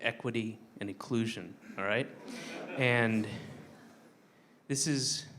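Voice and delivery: put-on low, soft, earnest speech to a child; quiet, tender plea to the kiddo; tender heart-to-heart. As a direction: speak gently and sincerely to the kid.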